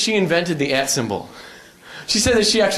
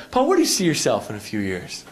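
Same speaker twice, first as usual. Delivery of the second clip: lower, softer counselor; guidance counselor voice; 8 semitones lower, 3 dB quieter than usual. Retold or imitated. Counselor quizzing the student about his future. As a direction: ask like a calm counselor. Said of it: "she was like".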